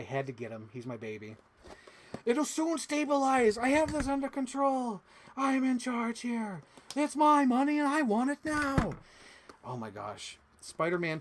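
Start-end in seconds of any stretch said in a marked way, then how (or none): 2.2-8.9 Doctor Octavius impression. Quotes Doctor Octavius from Spider-Man two, hammed-up villain. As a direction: play the mad scientist losing control. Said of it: "Doctor Otto Octavius".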